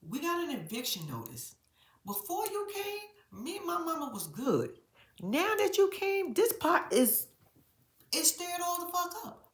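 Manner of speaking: high, loud exaggerated teen complaint; ad-libbed daughter rant. Lena complaining to Cameron, high hammed-up rant. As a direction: voice the upset daughter, hamming it up. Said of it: "she didn't say it like this, ad lib".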